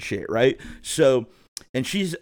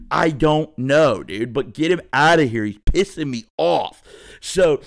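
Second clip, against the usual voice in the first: normal talking voice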